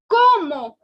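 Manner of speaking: comment said "como", the final NT silent; French comment. Shown as a demonstration. Teacher correcting comment to "como". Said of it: "It is".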